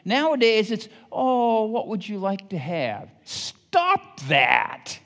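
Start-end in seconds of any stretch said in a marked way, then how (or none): 1.1-3.1 mock coddling parent. Mocking modern coddling parents. 3.2-5.0 stern scold. Real, not acted. Preacher scolding the coddling.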